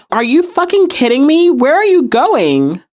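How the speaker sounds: mock outrage without raising the volume; acted incredulous protest. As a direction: retell a stunned plea to someone leaving. Abandoned Piper's protest acted out.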